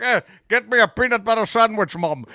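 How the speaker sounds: mock Scottish brogue, slushy S's; Sean Connery. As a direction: ham up a Scottish star miscast in a role. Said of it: "he would just be like".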